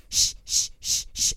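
repeated unvoiced shushes; whispered shush. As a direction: shush the baby over and over. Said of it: "the mom was like".

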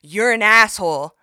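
mock loud insult. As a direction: fire it across the gym. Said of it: "really loud".

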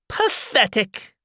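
put-on woman sneers at the fleeing girl, slow and high; scornful. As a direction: drag out the contempt.

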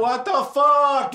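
yelled slowly, high, through laughter; shouting. Acted. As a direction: shout it up into the air. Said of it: "I, I shout".

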